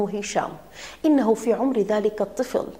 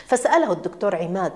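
usual speaking voice

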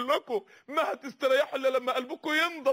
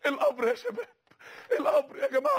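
much higher, pleading cry; anguished cry; 12 semitones higher than usual. Real, not acted. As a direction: cry out to the youth in alarm. Preacher crying out warning.

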